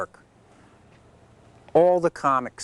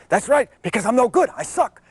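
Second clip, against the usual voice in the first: imitating Rodney; 10 semitones higher, 4 dB louder than usual. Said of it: "He says".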